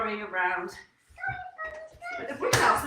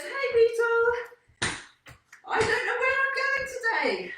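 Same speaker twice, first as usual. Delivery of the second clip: high, louder storybook bee voice; bee calling out; 10 semitones higher, 4 dB louder than usual. Acted. Lost bee hails the beetle for directions, calling out high. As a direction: call out high to a friend.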